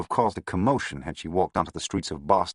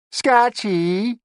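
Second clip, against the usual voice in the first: high whining Bronx moll; whiny schoolgirl voice; 7 dB louder than usual. A narrator's reading. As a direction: whine like a sulky schoolgirl. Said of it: "whining voice of a schoolgirl".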